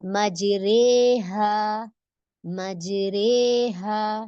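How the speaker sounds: slow, careful मजरेहा with re halfway between ra and ri; slow demo of मजरेहा with imala; imala, in-between vowel. Shown as a demonstration. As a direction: model मजरेहा slowly for the students. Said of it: "बीच की कैफियत क्या है इसकी".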